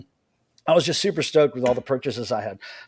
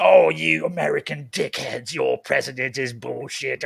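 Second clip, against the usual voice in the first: mock rude caricature. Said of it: "flips the switch and says".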